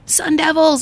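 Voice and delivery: high-pitched imitated cheer; mock calling out. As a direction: call it like a fan from another car. Retold driver calling between cars.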